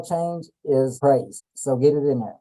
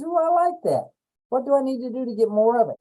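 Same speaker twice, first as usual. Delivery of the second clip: put-on high, eager childlike voice; the brain begging for more; delighted brain voice; 8 semitones higher than usual.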